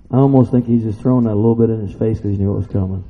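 usual speaking voice

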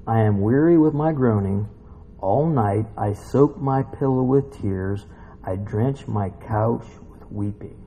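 low, slow psalm lament; solemn scripture reading. Acted. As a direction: read it gravely, softening toward the end. Reads psalm aloud.

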